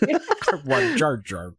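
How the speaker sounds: high, slow, laughing whine; whiny baby voice. Retold or imitated. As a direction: whine it like a spoiled toddler. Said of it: "whiny baby version".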